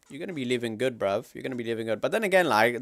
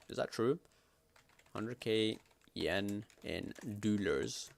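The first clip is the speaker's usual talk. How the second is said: ordinary delivery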